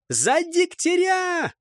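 high, drawn-out war cry; distant battle cry. A narrator's reading. Attackers on the riverbanks yell at the squad, battle cry. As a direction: holler it across to the enemy. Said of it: "неслось с берегов".

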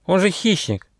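high, indignant little squirrel; crying out in protest. Acted. Young squirrel balks at helping a predator, crying out. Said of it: "крикнул Бельчонок".

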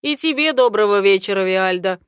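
loud, quick mock-grand greeting; theatrical greeting. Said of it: "Ларс театрально разводит руками".

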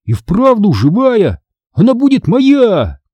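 put-on high, stretched-out boyish exclamation; marvel, then claim it greedily; thrilled child, slow drawn-out words; excited claim. A narrator's reading. Boy excitedly claims the sponge.

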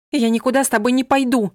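громкий высокий вскрик; выкрикни это выше и громче. A narrator's reading. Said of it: "вскрикнула она". (гневно вскрикивает на Ромаля)

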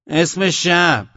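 long-drawn, high, loud challenge; sentry's shout. A narrator's reading. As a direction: stretch the challenge out slowly at full voice. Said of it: "نگهبانی که سر راه بود فریاد زد". (فریاد کشدار نگهبان)

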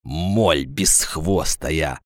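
slow, low, quiet scorn; slow contemptuous put-down; contemptuous insult. A narrator's reading. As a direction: drag it out low and soft, as if about to spit. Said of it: "сказал Агафонов и плюнул".

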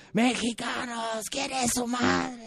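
high, slow, stretched-out oratory; arenga al pueblo. Acted. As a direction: imitate a president calling from the palace balcony. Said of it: "sale desde el Palacio Nacional y grita al pueblo". (arenga aguda y lenta desde el balcón)

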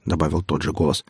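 plain reading voice

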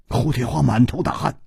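ordinary delivery, nothing marked

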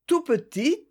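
"tout petit" dit bien plus haut; tout petit insisté. A narrator's reading. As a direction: monter la voix sur "tout petit". Répétition insistante. ("tout petit" prononcé plus aigu, insistant)

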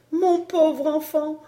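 very high, slow, drawn-out lament; anguished wail. Acted. Mother wailing over her dying child, high and slow. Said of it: "Zeffen ne cessait de crier".

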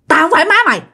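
high, harsh quick snarl of a strange woman; menacing ghost woman voice; fierce old woman. Retold or imitated. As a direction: spit it out fast and hostile. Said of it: "cái giọng bà rất là hung dữ, nói rất là nhanh".

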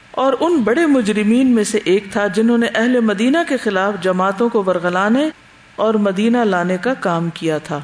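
usual speaking voice

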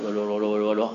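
low, soft gibberish chant; mock mumbled incantation. Teacher mocking a healer's unclear mumbling, low and soft. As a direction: mumble it like a quack's spell. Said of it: "kalau ruqyah mengatakan".

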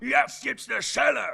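slow, high, shouted threat; comic threat. Acted. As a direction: bellow a cartoon threat. Threatens in put-on voice.

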